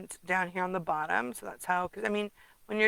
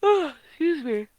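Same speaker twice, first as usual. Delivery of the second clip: talking through a yawn; 7 semitones higher, 7 dB louder than usual. Real, not acted. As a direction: yawn as you say it. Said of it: "As I yawn".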